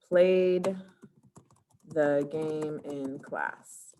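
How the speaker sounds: spaced out slowly, word by word; drawn-out, measured dictation of an answer. Shown as a demonstration. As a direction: dictate it slowly for someone typing. Dictating what students should type.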